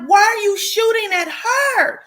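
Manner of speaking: high, loud, half-laughing shout; retold yell. Shouting at the woman shooting the wrong people, loud and high. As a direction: yell at her in disbelief.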